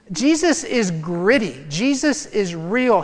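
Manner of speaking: ordinary talk, nothing marked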